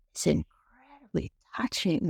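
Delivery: breathy whisper, moved by it; hushed whisper. Real, not acted. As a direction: drop to a whisper as if touched. Moved speaker describing tender scene.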